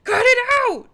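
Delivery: high, loud yell at the chat. Real, not acted. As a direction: yell at them to stop, half laughing. Viewers keep donating, she yells.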